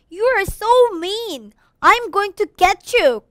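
high, slow, drawn-out outcry; acted high-pitched, wounded yell; crying out. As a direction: cry out in protest, vowing revenge. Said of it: "cried Jude".

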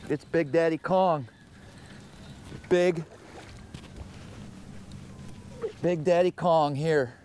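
high, slow, drawn-out hype; hyped announcement. Real, not acted. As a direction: announce the giant fish proudly. Hyping a big catch.

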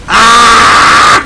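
loud, high drawn-out "ah"; long yell at your own mistake; exasperated sustained scream; frustrated scream. Real, not acted. Screams at own repeated slip.

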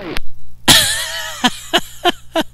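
long, high, breathy burst of laughter. Real, not acted. Laughing at radio banter.

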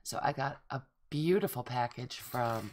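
ordinary talk, nothing marked

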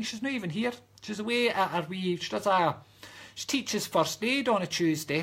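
normal talking voice

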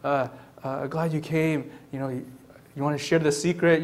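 hushed, low and hesitant; soft, low confiding voice; secretive. Acted. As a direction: murmur it like a shy secret. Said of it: "that it cannot be hidden".

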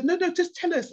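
put-on high pleading kids' voice; mock child urging; children's voices. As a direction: lift it up high like a child begging.

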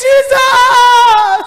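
frantic pleading shout for a mother; shouting to Jesus. Retold or imitated. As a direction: cry out to Jesus with all your voice. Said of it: "I shouted with all my voice".

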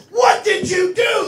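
high, loud, drawn-out mock horror; outraged parent. Parent reacting to the class trip, hammed-up outrage. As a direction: cry it out slowly in acted shock.

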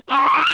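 high, loud cry of "ah"; sudden yell. Real, not acted. Viewer reacting to the chip video, sudden high yell.

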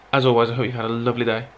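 ordinary talk, nothing marked

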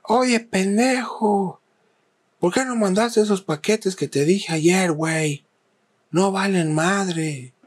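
high, slow mock yell of a rude boss; mimicking the yelling boss. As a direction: play the abusive boss barking orders.

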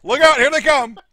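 high, loud shouted warning; excited warning. Real, not acted. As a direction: yell it out as the action closes in. Shouted warning during the action.

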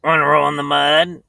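lightly voiced pig; a hint of a pig voice; oinking pig. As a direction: oink out like a storybook pig. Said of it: "asked the pig".

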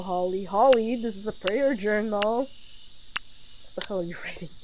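ordinary talk, nothing marked

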